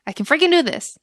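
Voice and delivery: rising to a fired-up cheer; pumped up. Retold or imitated. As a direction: psyche yourself up out loud. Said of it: "I was thinking".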